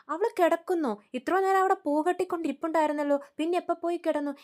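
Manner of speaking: mother-in-law doubting the son's complaint, pitched high in surprise; surprised. Retold or imitated. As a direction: act surprised and suspicious.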